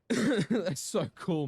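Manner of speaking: slow, loud, high drawn-out gush. Real, not acted. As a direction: stretch "so cool" in delight. Drawn-out gushing.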